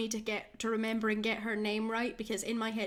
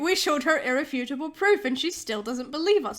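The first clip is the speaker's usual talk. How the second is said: exasperated; 4 semitones higher, 6 dB louder than usual. Real, not acted. Exasperated outburst.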